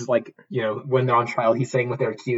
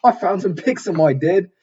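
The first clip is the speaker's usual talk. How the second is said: high, loud mock-Cockney cry; bad Cockney accent; 6 semitones higher, 5 dB louder than usual. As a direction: imitate a posh guy faking Cockney. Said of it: "That's, that's his accent".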